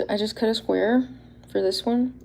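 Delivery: ordinary delivery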